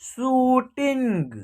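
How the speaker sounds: शूटिंग slow, with a long शू; drawn-out pronunciation. Shown as a demonstration. Teacher repeating शूटिंग for learners, long ऊ held. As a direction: stretch शूटिंग syllable by syllable. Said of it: "इस प्रकार इसका उच्चारण होगा".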